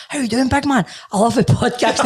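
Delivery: a hint of a fan greeting him; cheery passing fan. Passerby on the street greets him, lightly cheery. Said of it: "a guy passed him in the street, like".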